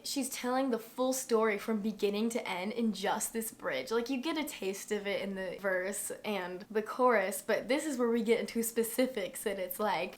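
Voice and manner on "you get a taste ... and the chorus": ordinary delivery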